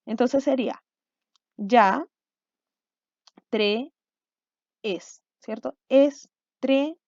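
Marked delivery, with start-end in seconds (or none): 1.6-5.2 "ya", "tre", "es" each alone between long pauses; isolated syllables. Shown as a demonstration. Teacher filling boxes from back to front, isolated syllables. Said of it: "Vamos a partir de atrás para adelante". 5.9-7.0 slow "Estrella" in three separate pieces; syllable by syllable. Shown as a demonstration. Teacher placing syllables in boxes, "Estrella" split slowly. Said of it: "Voy a situar esta palabra en cada casillero".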